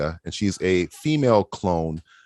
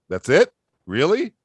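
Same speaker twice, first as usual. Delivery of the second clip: incredulous; 7 semitones higher than usual. Retold or imitated. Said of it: "it's just like".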